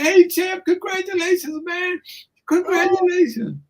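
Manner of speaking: high, thrilled greeting; excited fan. Retold or imitated. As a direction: gush at your hero. Stunned fan greets the champ.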